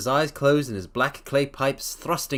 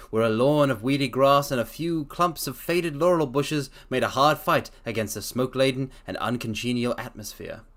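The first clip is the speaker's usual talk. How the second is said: usual speaking voice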